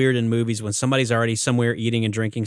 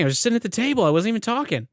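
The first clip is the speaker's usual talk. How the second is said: high whiny protest; mock innocent protest; 11 semitones higher, much faster than usual. [name] protesting he did nothing, high and whiny. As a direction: whine your innocence in a high voice.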